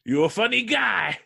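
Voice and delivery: high, loud tough-guy impression; Clive Owen. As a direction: snarl it like a movie heavy. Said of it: "I do a Clive Owen".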